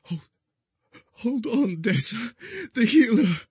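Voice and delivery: high, strained pleading voice; choked. A narrator's reading. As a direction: plead while choking back tears. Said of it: "Ash choked".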